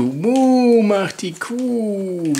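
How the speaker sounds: slow moo. Acted. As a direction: draw out the moo slowly. Drawn-out cow sound for baby.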